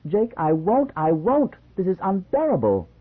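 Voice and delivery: high, loud petulant refusal; stomping tantrum. A narrator's reading. Young wife refusing her husband, loud high tantrum. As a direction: throw a shrill tantrum at him. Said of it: "She stomped her bare foot".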